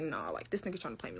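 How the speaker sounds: a suspicious aside under the breath; suspicious inner thought. Retold or imitated. Narrator realizing she is being played, muttered low.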